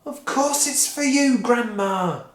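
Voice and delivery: butter her up; sweetly. Acted. Mister Cranky sweet-talking Grandma, sugary. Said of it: "he smiled sweetly".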